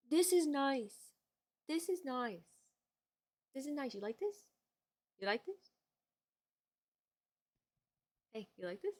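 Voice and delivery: soft, hushed, cuddly murmur; tender murmur. Real, not acted. Streamer murmuring softly to the dog cuddled in her arms. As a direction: coo to the dog as he settles.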